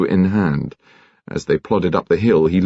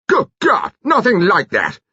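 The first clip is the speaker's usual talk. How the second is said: shouted; 13 semitones higher than usual. A narrator's reading. Seiss shouting his denial at the detective. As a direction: explode in shocked indignation. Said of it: "Seiss shouted".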